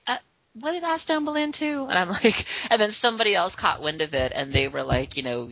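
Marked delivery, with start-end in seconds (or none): 0.1-1.9 higher, puzzled Southern voice; mock a confused Southern girl; light Southern accent. Twitter friend not getting the joke, light Southern accent. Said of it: "imagine her saying these things with this adorable Southern accent".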